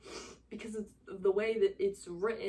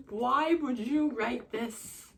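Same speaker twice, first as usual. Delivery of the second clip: slow, drawn-out, louder why; slow louder anguished question; exasperated plea; 3 semitones higher, 6 dB louder, much slower than usual. Real, not acted. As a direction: plead with the author, exasperated. Upset at the author.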